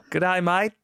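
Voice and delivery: a cheery hint of Aussie bear; Australian Pooh. Teasing co-host about the new Australian pundit, mock Australian accent. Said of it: "Australian Winnie the Pooh saying".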